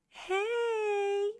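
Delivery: high, stretched-out hey; put-on high greeting, held long; Alicia's funny voice. Imitating Alicia for the viewers, funny high voice. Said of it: "But she'd be like".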